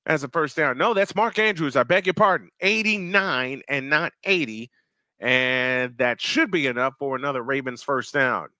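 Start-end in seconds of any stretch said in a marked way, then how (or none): none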